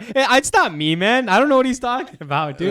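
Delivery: high, loud protesting innocence; fake innocent denial. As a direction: overplay the innocent act. Retelling his acted denial in game.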